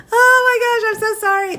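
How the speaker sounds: squeaky, embarrassed oh my gosh, rising high; flustered apology shooting way up in pitch; high flustered gasp. Real, not acted. Streamer apologizing to chat after a mix-up, high and flustered.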